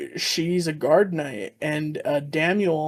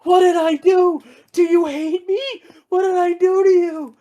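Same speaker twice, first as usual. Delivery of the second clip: much higher and louder, hammed-up despair; wailing shout; 12 semitones higher, 7 dB louder than usual. The guard knight begs her lady, hammed-up wailing shout. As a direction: howl it like a heartbroken knight.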